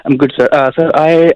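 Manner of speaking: ordinary talk, nothing marked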